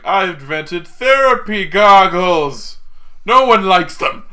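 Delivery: loud, high, drawn-out mock proclamation; over-the-top inventor showing off; mock proud announcement. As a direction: announce it grandly, stretching the words. Mock Tony Stark announcing invention.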